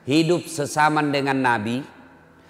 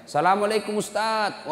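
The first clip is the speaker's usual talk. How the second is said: higher, mildly plaintive greeting; mock tearful phone greeting; imitated tearful woman; 8 semitones higher than usual. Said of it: "Sambil menangis ini ibu". (menirukan ibu menangis)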